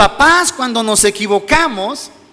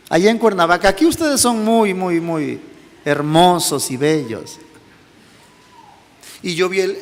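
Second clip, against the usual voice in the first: normal talking voice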